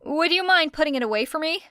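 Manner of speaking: hasty request. A narrator's reading. Era hurrying off, asking Hatsume to pack up, hasty. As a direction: rattle it off on the way out. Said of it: "said Era hastily".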